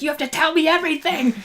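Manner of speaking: high, fast imitation of eager pleading; so excited. Host as Luca jumping to learn, excited. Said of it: "so excited about it".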